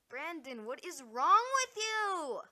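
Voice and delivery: loud, rising yell, drawn out at the end; exasperated shout. Acted. Calling out an absent friend over what is wrong, exasperated shout. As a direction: build from a call into a high, stretched-out holler.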